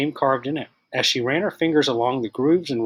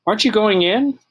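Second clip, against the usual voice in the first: put-on bright curious boy; 9 semitones higher, 6 dB louder than usual. A narrator's reading. Friendly boy at door.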